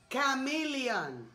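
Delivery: "Chameleon" said slowly, syllable by syllable, "kamilian"; slow model word. Shown as a demonstration. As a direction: drag out "Chameleon" so the kid can copy it. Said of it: "Dale otra vez".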